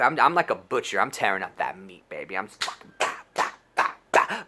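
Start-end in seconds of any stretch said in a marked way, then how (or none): 3.0-4.4 panted bah bah, then a high yelp; breathy grunts rising to high yelps; mock thrust grunts. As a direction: grunt the rhythm of the act. Mimicking thrusting sounds.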